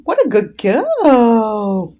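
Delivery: loud, slow, doting praise; gushing praise. Real, not acted. Owner doting on her dog after success, loud and slow. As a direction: stretch out the praise lovingly.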